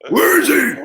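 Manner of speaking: high, loud shouted Batman demand. Retold or imitated. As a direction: yell it as a furious Batman. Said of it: "That was good".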